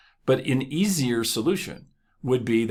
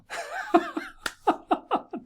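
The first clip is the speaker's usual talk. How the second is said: high burst of laughter. Real, not acted. Laughing at own story.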